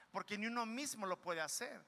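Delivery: ordinary talk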